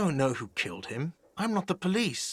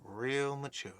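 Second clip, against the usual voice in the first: quieter, sulky muttered repeat; 2 semitones lower, 7 dB quieter than usual. Acted. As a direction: mutter it sulkily under breath. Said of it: "a whiny voice shouts".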